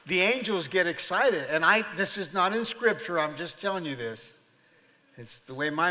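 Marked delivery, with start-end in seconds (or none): none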